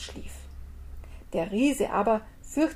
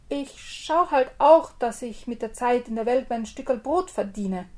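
ordinary delivery

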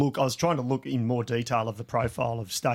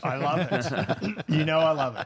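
laughing delight; 3 semitones higher than usual. Real, not acted. Cohost laughs about Belgium stages.

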